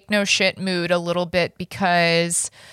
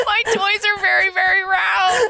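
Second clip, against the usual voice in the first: very high, shrieking with laughter; squealed, cracking up laughing; laughing repeat; 12 semitones higher than usual. Retold or imitated. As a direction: repeat it in helpless, high-pitched hysterics. Shrieking repeat of friend's accent attempt.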